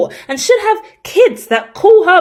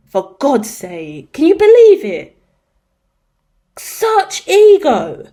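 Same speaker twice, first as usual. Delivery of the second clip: indignant; slower than usual. Real, not acted. Ranting about the family.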